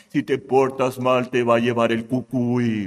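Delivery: low, monotone threatening parent; low-voiced bogeyman threat; parent's warning. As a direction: drop low to warn a naughty child.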